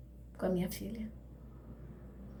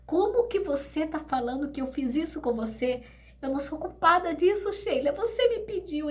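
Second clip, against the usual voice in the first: pitched-up desperate denial; anguished protest; 6 semitones higher than usual. Retold or imitated. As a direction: defend yourself in rising anguish. Protesting to dead daughter.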